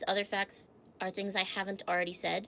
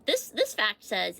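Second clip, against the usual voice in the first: ordinary delivery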